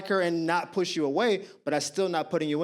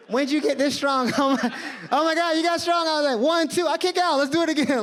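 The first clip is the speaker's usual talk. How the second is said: playful wrestling; 8 semitones higher, 3 dB louder than usual. Retold or imitated. Mock wrestling with crush.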